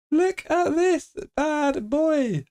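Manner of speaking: very high, very slow; slow-motion high-pitched boast; drawn-out showing off. Real, not acted. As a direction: stretch every word out long and high.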